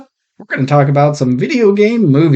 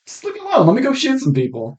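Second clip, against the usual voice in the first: high exasperated plea; 10 semitones higher, 4 dB quieter, faster than usual. Retold or imitated.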